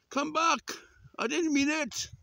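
high-pitched calling plea; calling after them. Real, not acted. Walker begs fleeing animals back, high call.

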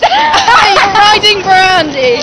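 high, slow teasing call; excited teasing. Real, not acted. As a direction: draw it out, teasing. Teasing friend on ride.

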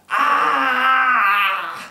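long, loud, high-pitched cry of despair; anguished wail. Acted. Poet breaking down over lost Sandra, anguished wail. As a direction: let out one drawn-out howl of grief, sinking at the end. Breakdown at end of grief poem.

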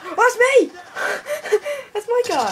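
high, loud surprised laugh; laughing surprise. Real, not acted. Gamer laughing after shooting his own guy. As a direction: crack up at your own mistake.

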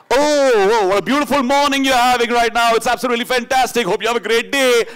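loud, high-pitched morning DJ gush; hammed-up over-the-top breakfast show greeting; fake radio cheer. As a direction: gush with phoney on-air enthusiasm at full volume. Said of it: "I made a profession of lying".

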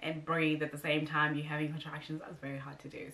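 ordinary talk

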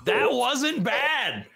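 high-pitched, impressed exclamation; excited praise. Real, not acted. Host cheers co-host's American accent attempt, excited. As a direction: pitch leaping up in delighted approval.

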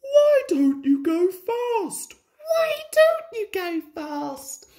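very high, squeaky anxious call; two little carriages calling out in worry; high-pitched fretful cry. A narrator's reading. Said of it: "called Annie and Clarabel anxiously".

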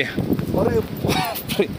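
breathless frightened whisper, slow; a real whispered oh my god on a slippery slope; scared whisper. Real, not acted. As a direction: gasp it out under your breath in fright. Slipping on steep cave path.

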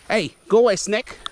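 high, loud shout at a snake; shooing a snake. Real, not acted. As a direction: yell it away from you.

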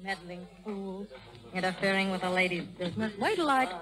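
low, soft grumble under her breath; muttering to herself. Acted. Laundress alone after the scuffle, muttering under her breath. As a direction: grouse quietly, alone.

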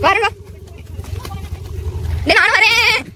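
loud calls from a moving bike; shouting while riding. Real, not acted. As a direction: call out loudly over the road. Calling out while riding bikes.

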